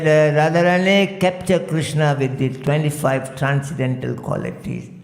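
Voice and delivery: ordinary talk, nothing marked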